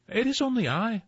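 very high, tiny goat voice; tiniest billy goat. A narrator's reading. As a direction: squeak it out as a little goat. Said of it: "said the tiniest billy goat Gruff".

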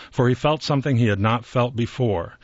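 ordinary delivery

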